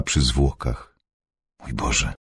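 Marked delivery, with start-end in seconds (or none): 1.6-2.1 soft, low sigh of dismay. A narrator's reading. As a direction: breathe it out low and quiet, as a sigh. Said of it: "westchnęła". (westchnienie, cicho i nisko)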